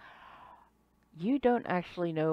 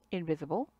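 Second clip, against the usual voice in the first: ordinary talk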